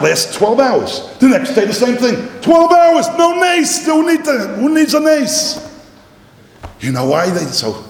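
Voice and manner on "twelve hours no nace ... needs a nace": high and loud; excited outburst. Acted. Teacher exclaiming the point to his class. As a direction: burst out that nobody needs a miracle.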